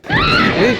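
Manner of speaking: piercing high shriek of shock; real scream at a jump scare; startled scream. Real, not acted. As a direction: scream out in sudden fright. Jump scare in horror game.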